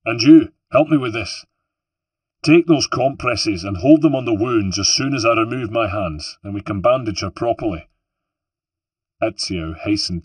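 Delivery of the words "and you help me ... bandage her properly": louder, higher, firm orders; a brisk field doctor taking charge; commanding surgeon. Acted. Antonio directing Ezio urgently.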